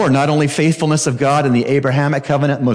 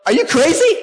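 incredulous vernacular. Acted. Preacher gives Paul's denial in plain slang, high and incredulous. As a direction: scoff as if the idea is absurd. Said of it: "We might say in the, the vernacular".

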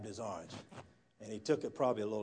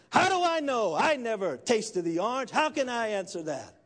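exasperated shout, starting at full pitch; angry outburst; 9 semitones higher, 6 dB louder than usual. Acted. Atheist finally blows up at the preacher's question, angry outburst. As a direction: blow up, loud and high. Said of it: "the atheist blew up and he says".

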